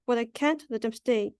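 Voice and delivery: ordinary delivery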